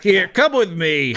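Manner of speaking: impatient bouncer; bouncer character. Acted. Bouncer voice leading player off.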